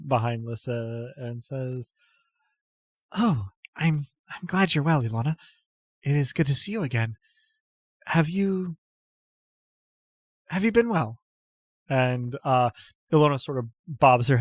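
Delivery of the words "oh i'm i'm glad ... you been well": higher, hesitant voice of a shy lady; a timid goddess-follower greeting an old friend; timid noble lady. Acted. Apatia greets Ilona, higher and hesitant.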